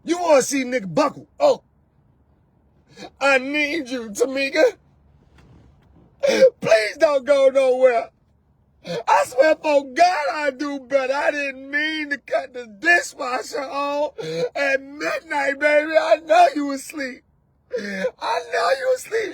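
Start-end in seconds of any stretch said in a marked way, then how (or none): 1.4-17.2 hammed-up high sobbing plea; mock crying man. 17.8-19.3 mock sob. Crying peak of the parody.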